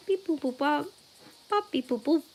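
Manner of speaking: quiet robotic beep-boop babble; beep boop noises. Shown as a demonstration. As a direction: softly make little computer boot-up noises. Shows her brain-booting noises.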